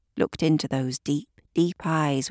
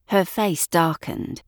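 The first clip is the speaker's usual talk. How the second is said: ordinary delivery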